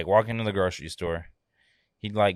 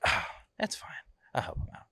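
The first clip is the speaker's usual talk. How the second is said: whispered quote; 6 dB quieter than usual. Retold or imitated. Customers quoted, said hushed.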